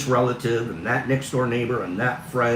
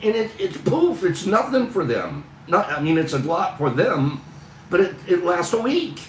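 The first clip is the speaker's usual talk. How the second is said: higher, louder, exasperated rant; real frustration rising into a louder, higher voice; frustrated; 8 semitones higher, 4 dB louder than usual. Real, not acted. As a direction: vent the frustration out loud, fast in places. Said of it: "You're hearing frustration in my voice".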